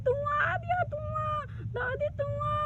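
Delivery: put-on high, tearful little child's wail; child crying. Guddu cries to his grandmother about the mouse, high and tearful. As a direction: whimper like a small frightened child. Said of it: "रोने लगता है".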